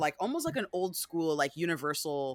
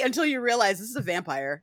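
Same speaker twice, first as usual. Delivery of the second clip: ordinary talk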